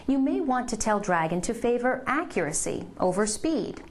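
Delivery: ordinary talk, nothing marked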